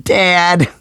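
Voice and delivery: high, loud scream of embarrassment; mortified scream. A narrator's reading. Daughter screaming at her father, mortified shriek. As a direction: shriek at your dad in horror. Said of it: "Samantha screamed".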